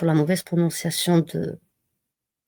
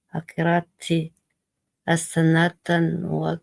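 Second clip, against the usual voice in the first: prayer recitation. Acted.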